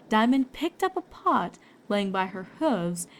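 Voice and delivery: plain reading voice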